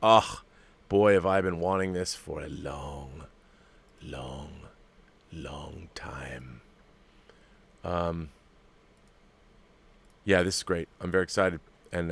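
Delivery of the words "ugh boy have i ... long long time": longing groan. Retold or imitated. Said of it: "I'm just looking at it going".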